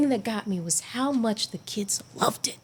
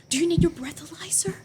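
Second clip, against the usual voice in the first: high-pitched imitation of worried onlookers; mock concern; 9 semitones higher than usual. Teammates asking the winded runner, high mock concern. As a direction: quote them in a high, thin whispery voice.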